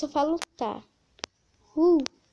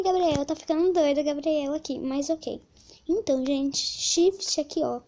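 normal talking voice

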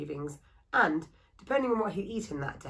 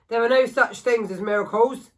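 put-on louder, bitter denial from a grumpy old carver; gruff woodcarver; 4 semitones higher, 9 dB louder than usual. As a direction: shut down her hope, firmly. Bitter woodcarver rejects miracles.

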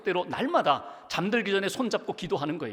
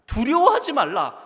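두려워하지 cried out louder and higher, falling at the end; emphatic repeat; 8 semitones higher, 9 dB louder than usual. Acted. Pastor driving home Jesus' command, loud and high. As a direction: proclaim 두려워하지 말라 at full force. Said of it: "repeats the command emphatically".

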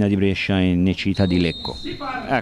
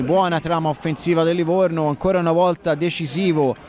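excited play-by-play; 6 semitones higher than usual. Acted. Attack build-up commentary.

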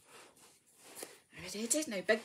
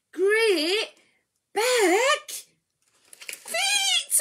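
rising louder to a high peak; building reveal; 12 semitones higher, 10 dB louder, much slower than usual. Acted. Reader building to the chick's feet, rising to high. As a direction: build up to the big word.

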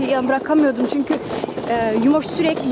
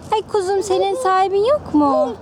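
high, sweet pet talk; cooing to a cat; 7 semitones higher than usual. Real, not acted. Woman cooing affectionately at a stray cat, high and sweet.

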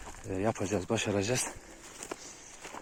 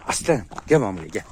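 ordinary talk

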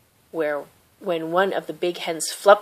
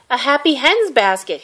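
called out again; 6 semitones higher, 4 dB louder than usual. Acted. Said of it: "he repeated".

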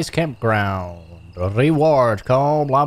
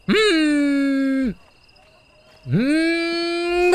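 long, high wavering-over-it "hmm"; high drawn-out hum; 14 semitones higher than usual. Real, not acted. Wavers over eating something rotting. (hoge, aangehouden twijfelende hmm)

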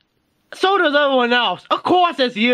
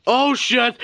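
plain reading voice

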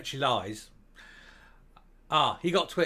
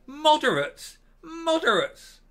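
much higher, louder disbelieving cry; incredulous outburst; 13 semitones higher, 7 dB louder, slower than usual. Real, not acted. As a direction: scoff in loud disbelief. Incredulous at claim.

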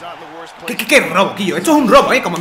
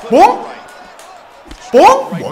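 punch yell; 10 semitones higher, 4 dB louder than usual. Real, not acted. Punching in fight game.

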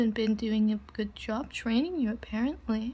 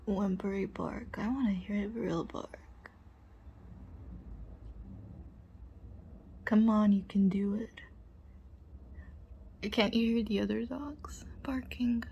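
whispering. Acted.

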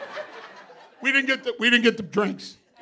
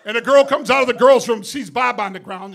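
ordinary talk, nothing marked

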